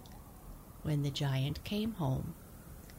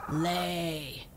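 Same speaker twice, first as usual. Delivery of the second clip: loud giant's bark of command; bellowing; 4 dB louder than usual. Acted. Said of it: "he bellowed".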